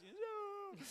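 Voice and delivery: a high, mock-horrified no; dismayed no. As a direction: cry out at the dog in high dismay. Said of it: "When he kills rabbits".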